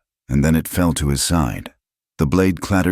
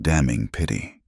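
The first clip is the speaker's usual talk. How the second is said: ordinary delivery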